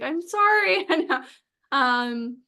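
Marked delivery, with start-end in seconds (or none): 0.0-0.9 high, sheepish apology; apologetic. Retold or imitated. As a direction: say sorry with a helpless shrug. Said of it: "I'm like".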